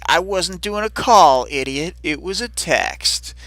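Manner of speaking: high, loud mock indignation; hammed-up irritated comeback; acted indignant retort. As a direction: correct them with exasperated scorn. Acts Amy snapping back at Taylor.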